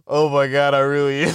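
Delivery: ordinary talk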